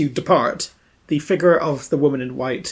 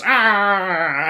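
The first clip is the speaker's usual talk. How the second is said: high mock victim's scream; imitated scream; 5 semitones higher than usual. Game master voices the bitten officer, mock scream. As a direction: cry out as the bitten cop, hammed-up. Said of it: "So you, he goes".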